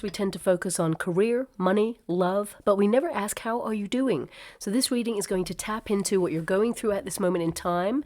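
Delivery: usual speaking voice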